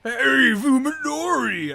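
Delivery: loud, high anime jock shout; dumb jock voice. Acted. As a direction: bellow it like a meathead anime jock. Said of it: "my monster voice is just sort of like that one dumb jock".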